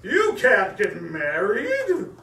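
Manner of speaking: slow, loud, menacing villain; scary voice. A narrator's reading. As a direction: drag each word out to frighten. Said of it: "said a scary voice".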